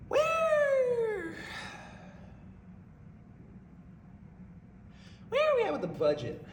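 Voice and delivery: high, drawn-out demand; mock pompous boss question. Prankster asking Marie about the budget, high drawn-out mock demand. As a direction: ask it like a smug manager. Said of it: "I said".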